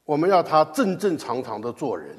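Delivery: usual speaking voice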